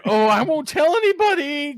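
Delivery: high-pitched mock plea; hammed-up high voice of someone caught out; pleading woman. Host voicing a woman who finds guns, mock pleading.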